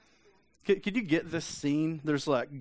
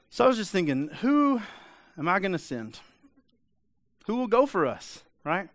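much higher and louder mock God asking around; playful God voice; 6 semitones higher, 4 dB louder than usual. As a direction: ham up a God calling out for a volunteer. God asks the room, mock.

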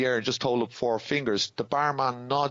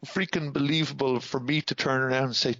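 ordinary talk, nothing marked

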